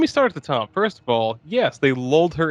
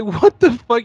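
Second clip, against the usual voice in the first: high, louder stunned exclamation; mock disbelief; 9 semitones higher, 5 dB louder than usual. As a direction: throw out a shocked what. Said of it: "I'm like".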